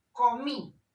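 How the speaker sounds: comí said loud and high, weight on final MÍ; stress on MÍ. Shown as a demonstration. Teacher giving a written-accent example, comí stressed on MÍ. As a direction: land hard on MÍ in comí. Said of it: "lleva tilde".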